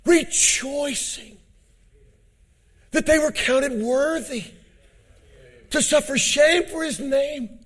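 soar up and proclaim it; preaching climax. Acted. Preacher crying out to the brethren about grace.

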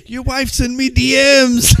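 high, slow shouted Irish taunt; Conor McGregor trash talk. Pretend. Friends joking about aliens, mock Conor McGregor trash-talk shout. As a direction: taunt like a cocky Irish fighter, yelling it. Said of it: "I'm sending Conor McGregor".

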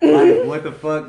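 high, loud, laughing outrage; laughing disbelief. Real, not acted.

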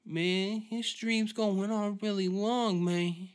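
high-pitched, drawn-out dopey voice; slow, high, head-knocked rambling; dazed fight-watcher character. Acted.

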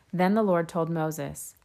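plain reading voice

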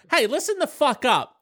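high, far-off preachy voice calling for attention; mock Jesus. Jesus telling the crowd to listen, in a high mock preacher voice. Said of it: "when Jesus says".

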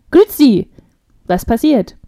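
sudden booming doctor's hello, lightly imitated; Austrian doctor. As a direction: burst in loudly as an Austrian doctor. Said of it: "von so einem österreichischen, äh, Arzt".

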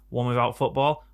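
ordinary talk, nothing marked

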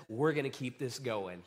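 low, soft statement of intent; calm Jesus. Acted. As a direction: say it low and composed. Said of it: "and then says".

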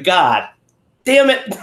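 laughing mock curse; amused exasperation. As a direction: burst out on the last words, about to laugh. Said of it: "I started laughing out loud. I was like".

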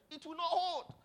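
very high-pitched insistent retort; acted high cry of defiance; emphatic refusal. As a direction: put your foot down, pitch way up. Papa's acted answer in the quarrel.

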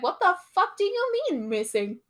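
high-pitched, indignant mock demand; retold incredulous outburst; blunt disbelief. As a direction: demand an answer, imitating her alarm. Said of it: "Obviously, she says it a lot nicer".

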